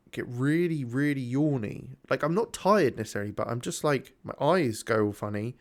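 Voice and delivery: ordinary talk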